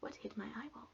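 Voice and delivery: hushed, unvoiced question; whisper. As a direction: whisper it in confusion. Confused whisper in crowd.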